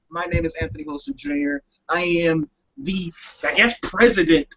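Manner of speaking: ordinary talk, nothing marked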